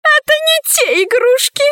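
very high, pouting whine; petulant heiress in a high voice; childish protest. A narrator's reading. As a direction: whine back like a spoilt little girl. Childish heiress whines back at father.

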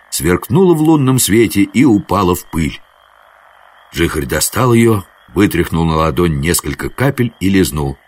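plain reading voice

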